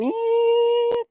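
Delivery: very high, sustained ringing tone; annoying whine. Shown as a demonstration. Showing how gamma waves sound, annoying high whine. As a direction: imitate a piercing steady frequency. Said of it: "annoying in a way. It's like".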